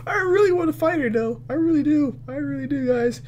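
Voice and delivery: squeaky confession to viewers; high-pitched pleading. Real, not acted. Player admitting to viewers he wants the fight, squeaky.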